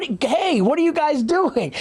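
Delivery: high-pitched mock outrage; indignant protest. Man on a date objecting to handsy waiters, mock indignant.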